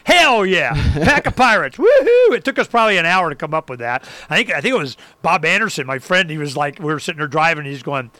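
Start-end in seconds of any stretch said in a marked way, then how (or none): 0.0-2.4 rising to a whooping high woohoo; gleeful cheer. Retold or imitated. Cheering his own pirate pack idea, gleeful whoop. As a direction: whoop it up like a pirate rallying the crew.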